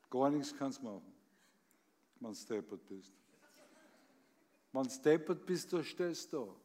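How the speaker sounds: dry, understated reciting of a comic poem; poem recitation. Acted. Reciting a birthday poem to her from memory, deadpan. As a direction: deliver the verses deadpan.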